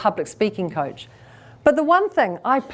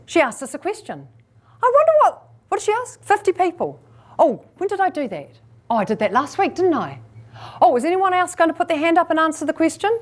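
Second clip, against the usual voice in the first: high-pitched audience member thinking it through; puzzled, higher-pitched thinking aloud; flustered inner thoughts; 6 semitones higher, faster than usual. Acted. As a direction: voice someone scrambling to process a question. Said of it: "inside Cindy's head she would go".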